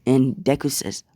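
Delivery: ordinary delivery, nothing marked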